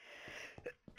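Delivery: dry rough cough. Real, not acted. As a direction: clear the throat with a cough. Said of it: "blame morning voice".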